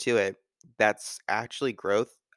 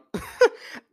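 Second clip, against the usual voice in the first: high laugh; 5 dB louder than usual. Real, not acted. Laughing at own mispronunciation.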